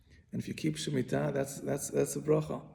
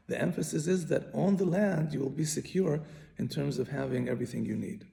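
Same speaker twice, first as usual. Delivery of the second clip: normal talking voice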